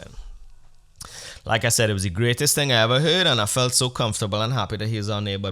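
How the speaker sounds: normal talking voice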